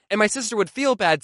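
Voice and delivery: usual speaking voice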